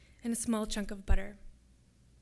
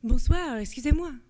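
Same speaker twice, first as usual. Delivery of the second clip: put-on louder soldier's greeting in accented French; slightly unfamiliar French accent; 4 semitones higher, 8 dB louder than usual. A narrator's reading. Soldier bursting into the compartment, louder French accent. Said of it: "with a slightly unfamiliar accent".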